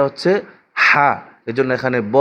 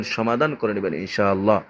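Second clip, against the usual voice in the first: normal talking voice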